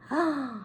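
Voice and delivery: sharp little gasp of pretend discovery; mock excited gasp. Teacher faking a sudden idea for the class, gasping. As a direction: gasp like the idea just hit.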